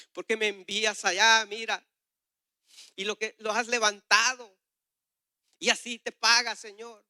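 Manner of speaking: loud, high pleading with God; anguished complaint. Retold or imitated. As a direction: protest as if hurt and bewildered.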